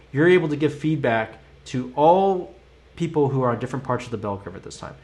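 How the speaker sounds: normal talking voice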